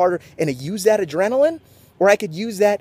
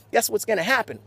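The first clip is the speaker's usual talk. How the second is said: ordinary talk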